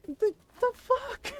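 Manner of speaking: high, slow, strained cry of disbelief; a wail of who does that; exasperated disbelief. Real, not acted.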